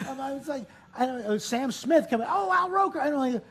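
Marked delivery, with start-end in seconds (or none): 2.3-3.0 high-pitched gushing recognition; imitating an excited admirer; star-struck greeting. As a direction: coo the name high, like a delighted fan. Acts out singer recognising him.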